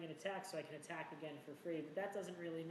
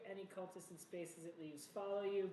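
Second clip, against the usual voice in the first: normal talking voice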